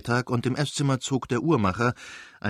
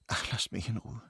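exasperated; 3 dB louder than usual. Acted. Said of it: "hatte sie gesagt".

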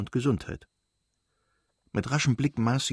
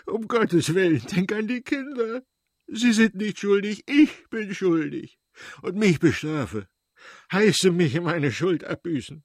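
high, desperate sobbing plea; 8 semitones higher than usual. A narrator's reading. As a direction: beg through sobs, taking all the blame. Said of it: "sagte er bereits schluchzend". (schluchzend und verzweifelt flehen)

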